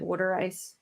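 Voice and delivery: put-on water as "wooder" in a Philly accent; casual Philadelphia "wooder ice". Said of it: "That's how all Philadelphians say it".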